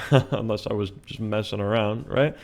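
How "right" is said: normal talking voice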